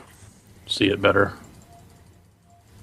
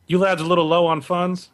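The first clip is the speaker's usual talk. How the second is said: higher, leaning-in barkeep asking; bartender voice; 6 semitones higher than usual. Acted. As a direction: lean in and ask like a friendly barkeep. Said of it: "and he goes".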